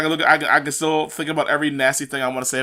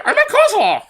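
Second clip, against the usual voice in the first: much higher, cartoon creature call; squeaky Pokemon voice; 4 dB louder than usual. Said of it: "Here's a friend, Greg".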